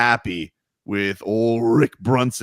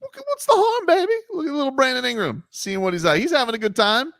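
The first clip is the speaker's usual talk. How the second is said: playful innocence; 12 semitones higher than usual. Pretend. Defending his looky-loo.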